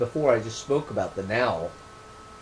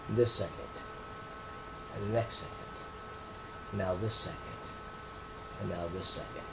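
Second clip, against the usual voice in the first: each "this second" softer and softer, paused; slow fading emphasis; 3 semitones lower, 13 dB quieter, faster than usual. Shown as a demonstration. Author drawing listeners into the present moment, fading to near hush. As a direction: let every "second" fade away, unhurried. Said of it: "I just want to emphasize that".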